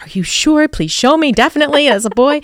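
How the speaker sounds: put-on high, loud, fast "really, a boy?"; reacting in mock shock at the sonogram; disbelieving surprise.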